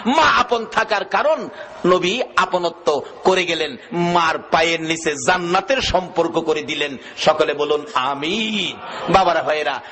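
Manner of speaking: usual speaking voice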